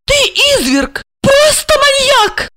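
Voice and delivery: high-pitched, appalled accusation; horrified. Acted. Wife recoils from her murderer husband, horrified. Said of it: "отшатнулась Ольга".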